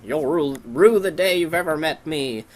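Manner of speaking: hammed-up vow of revenge; vengeful rival. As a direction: declaim it like a cartoon villain. Voicing rival's threat.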